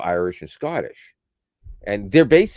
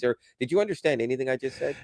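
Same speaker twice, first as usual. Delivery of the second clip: ordinary talk, nothing marked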